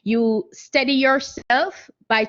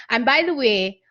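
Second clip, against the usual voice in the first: ordinary talk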